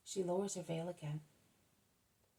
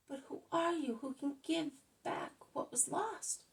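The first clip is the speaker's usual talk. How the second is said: higher, breathless wonder at the healer; a narrated cry of wonder, much higher; amazed joyful cry; 9 semitones higher than usual. A narrator's reading. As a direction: ask in astonished joy. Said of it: "he shouts and shouts".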